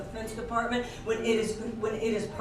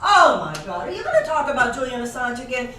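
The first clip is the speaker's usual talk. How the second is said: much louder, groaning mock disbelief; mock exasperation; 2 semitones higher, 9 dB louder than usual. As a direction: ham up the eye-roll, loud. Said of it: "somebody says".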